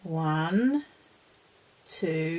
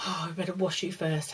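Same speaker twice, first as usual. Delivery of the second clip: ordinary delivery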